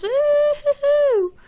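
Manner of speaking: slow, high, stretched woo-hoo; drawn-out happy whoop; excited whoop. Real, not acted. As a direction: cheer like you just struck it rich. Celebrating finding diamonds.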